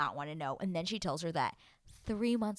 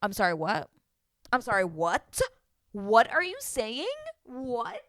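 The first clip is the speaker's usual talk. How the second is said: incredulous, getting higher as it repeats; disbelief; 4 semitones higher, 7 dB louder than usual. Acted. Recapper reacting to Lydia's claim, incredulous. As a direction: demand an explanation, loud and stunned.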